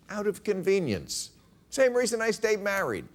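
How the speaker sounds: high-pitched, falling at each end; mock Hillary punchline. Hillary explaining her email account, mock matter-of-fact.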